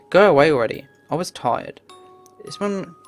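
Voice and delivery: usual speaking voice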